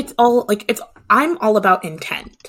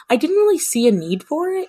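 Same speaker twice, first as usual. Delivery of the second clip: higher, airy self-quote; 10 semitones higher, 3 dB louder than usual. Retold or imitated. Her own reply acted out.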